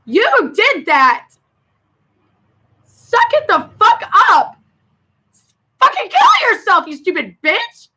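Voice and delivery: screamed high and loud, slowed and shaking; furious scream. Real, not acted. Screaming at her sister Ruth for what she did, furious.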